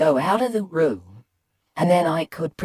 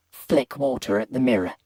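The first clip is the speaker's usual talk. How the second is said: ordinary delivery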